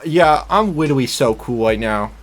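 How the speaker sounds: put-on baby-talk with R and L as W; uwu lisp. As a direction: say "literally" and "right" with W sounds. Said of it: "The uwu manager".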